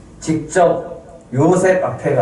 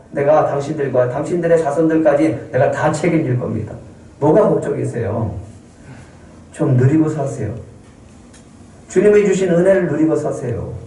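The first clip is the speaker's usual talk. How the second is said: usual speaking voice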